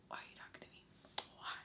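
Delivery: barely audible whispered question; hushed, voiceless mock plea; whispered disbelief. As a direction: whisper it under your breath. Same question muttered under breath.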